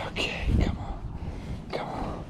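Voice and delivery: whispered, coaxing the fish; quiet urging under the breath; tense whisper. Real, not acted. Angler coaxing the fish in, tense whisper.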